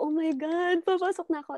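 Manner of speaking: retold excitement. Guest retelling her callback joy, mildly excited. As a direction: relive jumping for joy, mildly. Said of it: "talon na ako ng talon".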